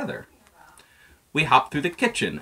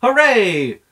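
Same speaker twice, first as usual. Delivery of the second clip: high, loud hooray; happy cheer; 11 semitones higher, 9 dB louder than usual. Acted. Bunny cheering the vote result, high and loud. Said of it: "said me, Marlon Bundo".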